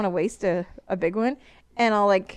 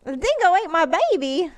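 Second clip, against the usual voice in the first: mock Australian accent that drifts Southern; Aussie accent; 6 semitones higher than usual. Shown as a demonstration. Doing her Aussie accent on request for her husband. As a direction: put on a bad Australian accent. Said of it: "my Aussie accent is more southern".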